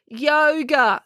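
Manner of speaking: high, drawn-out sarcastic answer. A narrator's reading. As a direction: toss it back with heavy sarcasm. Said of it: "said April sarcastically".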